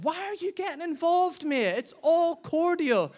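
high-pitched retold protest; indignant protest. Husband to meddling passenger, indignant protest. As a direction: push back, voice jumping up high.

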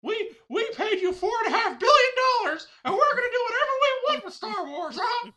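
very high squeaky cartoon mouse; Mickey Mouse voice. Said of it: "That's my Mickey".

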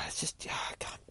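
whispered, trailing off; whispered aside. Real, not acted. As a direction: mutter it to yourself. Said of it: "maybe it's just the designer in me".

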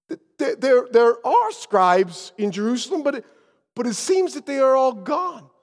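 high, stammering reply; flustered excuse. Acted. Guide explaining to travelers the missing scribes, flustered. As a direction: stumble over the start and plead helplessly.

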